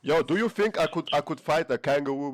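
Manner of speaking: ordinary delivery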